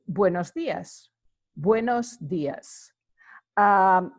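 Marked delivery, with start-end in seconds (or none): 0.0-2.9 Buenos dias said slowly, bwenos diəs; slow Spanish demo. Shown as a demonstration. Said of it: "you could say".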